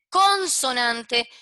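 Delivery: slow down and hammer "consonante" as a warning; stress on CONSONANTE. Shown as a demonstration. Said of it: "Ojo con esto. Yo dije los que".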